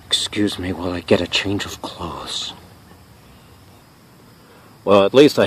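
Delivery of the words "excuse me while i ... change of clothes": low, soft and put out; subdued dismay. Acted. Drenched host leaving to change clothes, subdued dismay. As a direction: excuse yourself quietly after a soaking.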